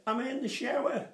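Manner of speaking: wife calling down from the shower, mock high voice. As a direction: play the wife shouting from the shower.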